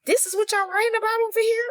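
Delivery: high, disbelieving squeak of a question; incredulous mock outrage. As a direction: act stunned at how dull it is. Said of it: "I'm like".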